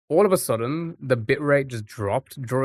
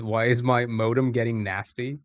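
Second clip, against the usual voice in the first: ordinary talk, nothing marked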